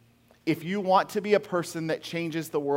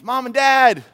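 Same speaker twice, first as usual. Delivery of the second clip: high, loud call from the roof; 8 semitones higher, 11 dB louder than usual. Retold or imitated. Son on the roof shouting to his parents below.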